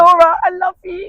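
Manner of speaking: very high, loud gush of love. Retold or imitated. To Laura after she says yes, a high loud gush. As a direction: squeal it with delight. Retold gushing reply.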